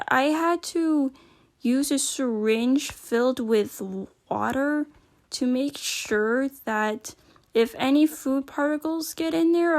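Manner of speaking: ordinary delivery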